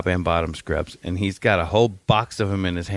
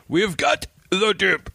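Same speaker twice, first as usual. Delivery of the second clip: higher, slow proclaiming voice; mock herald; 10 semitones higher, slower than usual. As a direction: announce it like a medieval crier. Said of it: "He said".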